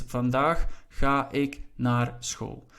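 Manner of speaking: slow, word-by-word Dutch reading; deliberate teaching pace; slow example sentence. Shown as a demonstration. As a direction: spell out the word order slowly for learners.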